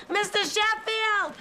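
high, slow, desperate call for help. Acted. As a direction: cry out his name from the closet. Stuck in the closet, calling for help.

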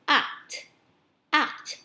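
"Act" with the final T clearly released; short "Act", crisp audible T at the end; popped final T. Shown as a demonstration. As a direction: pop the ending sound of "Act". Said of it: "cuối của ending sound lên".

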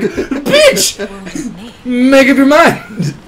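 high, loud, slow exasperated shout; mock yelling at a fickle companion. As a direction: drag out the frustration, laughing. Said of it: "And I'm like".

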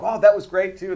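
high, loud gushing compliment; retold enthusiastic praise. Casting team praising the second audition, imitated enthusiasm. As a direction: gush like an impressed casting team.

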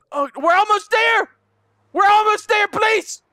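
high and loud, pleading; desperate urging. Real, not acted. Gamer racing to the goal, desperate pleading. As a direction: push hard for the finish line.

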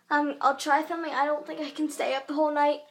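usual speaking voice

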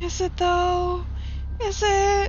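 high, suspicious questioning. Real, not acted. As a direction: doubt it out loud. Doubting the game's claim.

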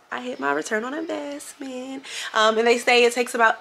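high, thrilled announcement; squealing proud news; excited. Real, not acted. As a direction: announce it with a rush of pride. Proud announcement, pitch jumps high.

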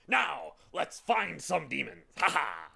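loud, swaggering battle cry; rally them loudly, end on a swaggering laugh; blustering cry. A narrator's reading. Humbug eager to find demons, loud bluster. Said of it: "cried the humbug".

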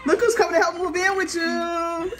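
crying with joy as big sister arrives; tearful surprise. Real, not acted. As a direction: mom welcoming big sister, voice high and teary with joy. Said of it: "Now you gonna have me crying".

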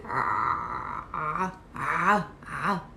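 soft, strangled "ah", throat pressed hard; strangled ain. Shown as a demonstration. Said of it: "press really hard on your throat".

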